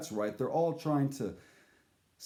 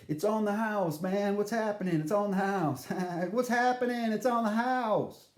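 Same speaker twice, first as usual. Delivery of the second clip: [name]'s voice; 8 semitones higher, 3 dB louder than usual. Retold or imitated. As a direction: offer it free, laid-back. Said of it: "he's like".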